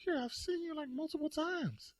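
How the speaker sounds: high laughing why are you talking to me like this; incredulous protest. Retold or imitated. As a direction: protest to the cop that he knows you. Protesting to cop, disbelieving.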